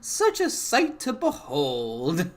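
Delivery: put-on slow, gloating old hag voice. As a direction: savor it like a witch. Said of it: "The hag cackles and".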